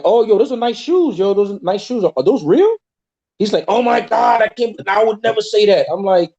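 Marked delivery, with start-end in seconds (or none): 0.0-2.8 higher-pitched mock admiration; imitated teasing compliment. Teaching his brother to neg a woman, teasing. Demonstrating how to tease a woman. 3.7-5.9 high-pitched mock horror; imitated shock. Brother reacting to a bold pickup line, shocked.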